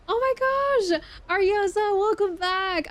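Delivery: high, loud squealed welcome; real excited shout, very high; excited greeting. Real, not acted. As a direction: greet a returning friend with a delighted squeal. Greets a returning viewer.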